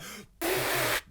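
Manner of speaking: a voiceless blown fart sound; imitated fart noise. Retelling his mate's fart, an imitated fart noise. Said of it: "like that".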